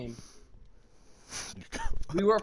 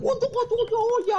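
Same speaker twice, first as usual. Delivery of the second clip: mock squeak. Squeaky mocking voice.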